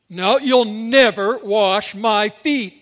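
acted disciple digging his heels in; emphatic refusal. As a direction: refuse point-blank, every word weighted. Reading Peter's refusal aloud.

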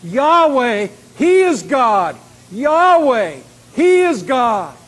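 loud, high, drawn-out proclamation; crowd's cry. Acted. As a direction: cry it out like an awestruck people. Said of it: "they fell prostrate and cried".

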